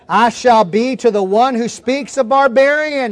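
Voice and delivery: ordinary talk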